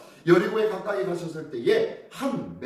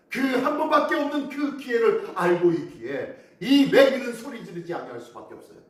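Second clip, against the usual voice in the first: usual speaking voice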